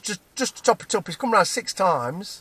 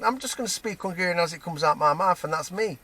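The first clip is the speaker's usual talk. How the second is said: ordinary talk, nothing marked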